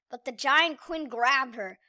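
plain reading voice

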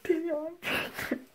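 rising, teary excitement; overwhelmed with joy, voice wobbling; excited near tears. Real, not acted. Discovering positive test, emotional.

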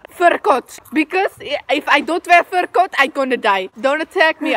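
bad fake Russian accent. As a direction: put on a clumsy Russian accent. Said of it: "I know that's not a Russian accent for English".

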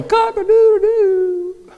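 high, drawn-out rooster call; rooster crow. Acted. Preacher imitating the rooster that Peter heard, crowing. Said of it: "like that little rooster crowing".

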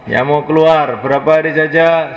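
loud, high rallying call to sign up; preaching at full force. Real, not acted. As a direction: rouse the crowd to stand and register. Ajakan berdiri dengan suara lantang. (seruan dakwah lantang dan tinggi)

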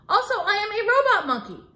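ordinary delivery, nothing marked

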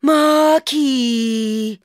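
high loud call from above; shouted name. Acted. Natasha calling down to Mark from above, loud and high. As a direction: shout it down from a balcony. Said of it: "a voice above us shouted".

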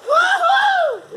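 high, loud whoop of triumph; excited whoop. Real, not acted. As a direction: cheer out loud. Cheer after success.

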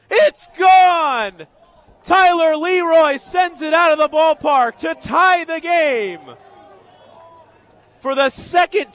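loud, high play-by-play shout; real sportscaster yelling in excitement; ecstatic home run call. Real, not acted. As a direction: explode as the ball leaves the park. Calls the tying home run live.

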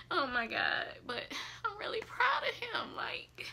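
tearful, whispered pride; emotional whisper. Real, not acted. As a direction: whisper it, on the verge of tears. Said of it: "he just make me emotional".

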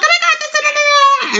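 high, breathless mock gushing; mock passionate gush. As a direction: show how she gets carried away. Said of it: "I get so passionate that I'm just like".

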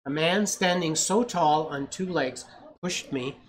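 gentle read-aloud storytelling; story narration. A narrator's reading. Parent reading a picture book to a child, storytelling voice.

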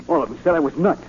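scared Spud protesting to the invisible voice; agitated outburst. Acted. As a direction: blurt it out in panic.

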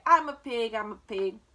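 loud, boastful chant; playful boy's yell. A narrator's reading. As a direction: belt it out, then drop the pitch. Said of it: "yelled Avery".

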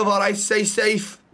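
friendly put-on Scouse; Scouse accent. Bystander says goodbye to patient, in Scouse. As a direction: sign off in a Liverpool accent. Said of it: "Scouse, okay".

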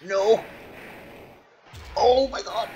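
high, loud yell of dismay; real high-pitched outcry; panicked cry. Real, not acted. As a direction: shriek it as everything goes wrong. Cries out at a game mishap.

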